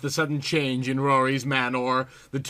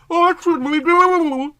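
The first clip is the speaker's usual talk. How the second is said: slow, much higher, louder anxious plea; fretting; 7 dB louder, slower than usual. Acted. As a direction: wring your hands over every word. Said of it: "fretted Callie".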